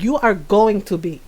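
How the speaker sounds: low, measured Filipino mom scolding; imitating stern mom. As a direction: play the mother pulling rank, voice dropped. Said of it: "she do my voice very well".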